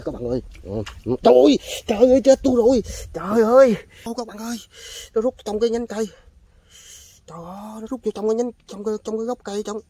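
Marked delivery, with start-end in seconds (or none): 1.2-3.8 much higher, much louder thrilled cry; real excited yelling; excited shout. Real, not acted. Big fish hooked, thrilled. 4.0-9.8 much higher, urgent excited report; real excitement, voice up high. Real, not acted. Fish runs into the roots.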